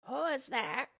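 a sly wolf straining to sound like granny; wolf faking old lady. Acted. The wolf answers the knock, faking granny's voice. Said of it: "trying hard this time to imitate the old lady's voice".

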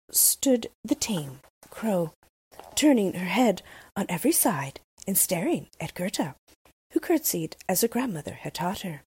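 usual speaking voice

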